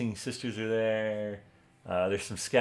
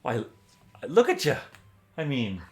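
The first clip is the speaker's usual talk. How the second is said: put-on louder, higher joyful hail to an old friend; delighted greeting; 5 semitones higher, 5 dB louder than usual. Torn overjoyed to see Finric again. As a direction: call out his name in happy surprise.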